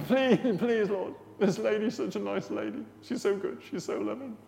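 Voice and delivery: high-pitched begging imitation; hammed-up wheedling to God; mock pleading prayer. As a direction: plead like an over-earnest petitioner.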